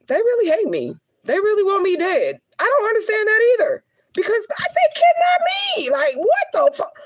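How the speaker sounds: fighting back tears and losing; real crying. Real, not acted. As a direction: voice breaking high into tears. Breaks down over kidnapping.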